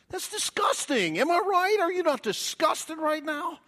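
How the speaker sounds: high, loud, revolted outcry; hammed-up disgust. Preacher asks congregation to share his revulsion, loudly disgusted. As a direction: appeal to the crowd in loud high-pitched revulsion. Preacher reacts to dirty finger demo.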